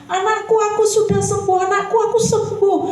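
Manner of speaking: high, joyful cry of a healed mother; mother telling her children she is healed; overjoyed mother. Acted. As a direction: burst out with joy, pitched high.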